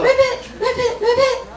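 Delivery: high squeaky ribbits; baby frog croak. Acted. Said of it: "Baby Ribbit".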